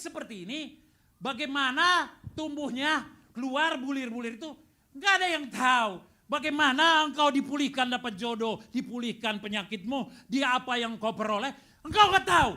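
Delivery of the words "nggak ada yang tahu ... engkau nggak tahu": loud, high build to a cry; rising preacher shout. Real, not acted. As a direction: climb to the peak of the sermon.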